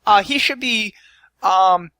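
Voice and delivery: a hesitant, fluttery helper; Tabitha voice. Acted.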